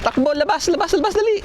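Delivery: yelling orders on the run from flies; while running. Real, not acted. (sumisigaw habang tumatakbo)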